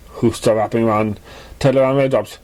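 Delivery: usual speaking voice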